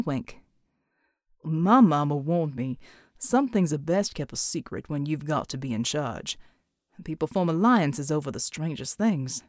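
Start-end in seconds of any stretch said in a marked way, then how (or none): 1.4-9.4 Pride's voice. Acted. Pride confiding to Mel, low and measured. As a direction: speak low and measured like a tired leader.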